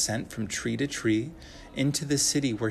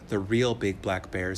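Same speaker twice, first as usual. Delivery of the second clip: usual speaking voice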